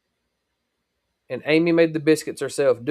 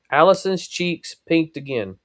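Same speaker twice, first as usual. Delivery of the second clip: usual speaking voice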